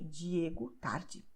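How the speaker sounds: Diego tarde with a j sound for each D; soft j sound on the D in Diego and tarde; D like jeans. Shown as a demonstration. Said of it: "the sound will be like jeans".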